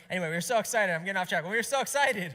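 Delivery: loud, high burst of excitement; excited insistence. Real, not acted. Preacher getting off track about a new baby, loudly excited.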